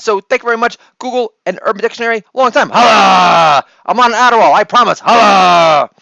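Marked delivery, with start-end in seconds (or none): 2.3-3.7 loud hype-man shout; big crowd-rousing holla. Acted. 3.9-5.9 loud jittery crowd shout; wired hype. Acted.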